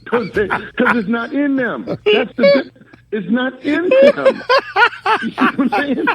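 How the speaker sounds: high, laughing through the words; can barely get it out for laughing. Real, not acted. Laughing while mocking clappers.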